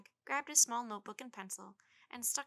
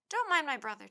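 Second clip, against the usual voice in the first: friendly, loud and high; shouting; 4 semitones higher, 11 dB louder than usual. A narrator's reading. Willie calls up to Jack about his little brother, friendly shout. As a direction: call up cheerfully over the distance. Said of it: "he shouted".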